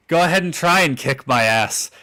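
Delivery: ordinary talk, nothing marked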